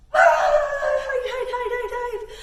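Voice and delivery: high, loud giggly cries of hide; laughing mock panic. Storyteller playfully panicking as worries come back, laughing shriek. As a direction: ham up the scare with a laugh.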